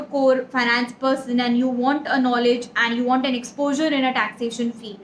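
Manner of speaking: usual speaking voice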